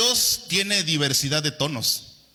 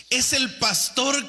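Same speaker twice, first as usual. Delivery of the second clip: higher, slow grumbling complaint; mock complaint; 8 semitones higher than usual. Congregant grumbling about the pastor, mock indignant. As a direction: sound offended by the sermon.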